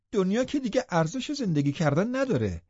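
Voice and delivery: high, loud mocking taunt; taunting outburst. Hindley jeering at Heathcliff through the window, high taunt. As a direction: jeer at him, voice climbing.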